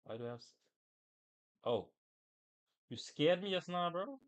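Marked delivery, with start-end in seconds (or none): none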